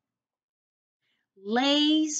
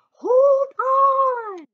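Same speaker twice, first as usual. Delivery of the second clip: urgent shout; 11 dB louder than usual. Shown as a demonstration. Stone calling to Stick in the wind, a very high loud hold on. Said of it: "another one of those exclamation points".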